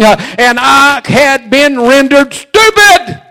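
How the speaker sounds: loud, rising preacher's cadence; full-force preaching. Acted. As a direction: drive it home to the congregation.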